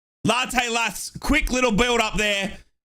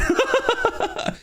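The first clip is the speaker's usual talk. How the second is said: airy high laugh; 14 semitones higher than usual. Real, not acted. Fan laughing at the bad shot, airy high. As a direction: laugh at the miss again.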